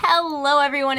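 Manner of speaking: ordinary talk, nothing marked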